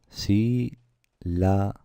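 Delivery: "Sílaba" very slowly as sí... la... ba; syllable by syllable. Shown as a demonstration. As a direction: break "Sílaba" into three separate syllables with pauses. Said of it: "Cuántas sílabas son". ("Sílaba" muy despacio, separada: sí - la - ba)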